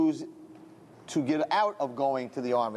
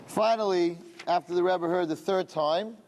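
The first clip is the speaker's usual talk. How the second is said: plain reading voice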